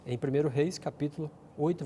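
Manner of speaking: ordinary delivery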